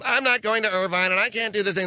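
a touch of the whining mother; whiny mom. Retold or imitated. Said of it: "the mom's on the cell phone".

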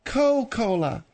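"Coca-Cola" as two parts, Co, Cola; no "ca" in "Coca-Cola", explained to Phil; dropped syllable. Shown as a demonstration. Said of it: "It's called Coke because".

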